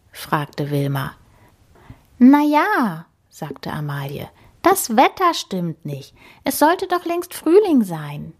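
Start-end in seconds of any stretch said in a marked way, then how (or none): 2.2-3.0 put-on doubtful wild boar girl. A narrator's reading. 4.6-8.3 much higher, puzzled; high voice, fretting about spring; young boar, high and put out; complaining wild boar girl. A narrator's reading.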